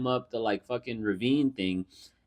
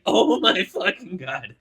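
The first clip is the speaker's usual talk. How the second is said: shocked laughing; 10 semitones higher, 5 dB louder, slower than usual. Retold or imitated. Own shocked reaction while laughing.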